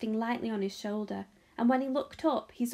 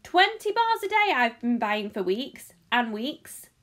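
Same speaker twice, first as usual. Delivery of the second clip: loud, slow indignant complaint; aggrieved boy's outburst; angry shouting; 6 dB louder than usual. A narrator's reading. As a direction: drag out the grievance loudly. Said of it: "a large boy shouted angrily".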